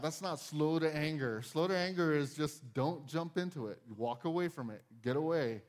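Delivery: ordinary talk, nothing marked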